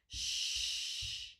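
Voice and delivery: "Shh" as one long, steady rush of air; long sh sound. Shown as a demonstration. Teacher showing the mouth position, long "Shh". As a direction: draw out "Shh" without voice. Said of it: "I hear the air come long".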